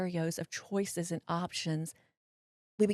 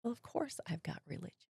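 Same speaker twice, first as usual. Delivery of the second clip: whispered, wounded protest; acted-out small, hushed defense; stunned hushed reply; 8 dB quieter than usual. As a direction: answer under your breath, taken aback. Retold stunned reply after the rebuke.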